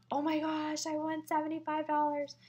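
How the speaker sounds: high put-on winner's voice; super excited. Guest wins the full card bingo, mock excitement. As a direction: imitate a thrilled winner, pitch held up high. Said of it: "super, super excited about it".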